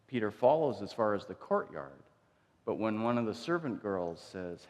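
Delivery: ordinary delivery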